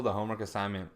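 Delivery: ordinary delivery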